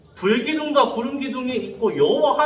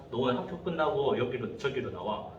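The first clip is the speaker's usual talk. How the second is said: a hint of a bully muttering a challenge, much softer and lower; quiet low threat; 10 semitones lower, 10 dB quieter than usual. Bully challenging the small boy to a fight, low and quiet. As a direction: voice the classmate's dare quietly and low. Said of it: "라고 도전장을 딱 낸 거예요".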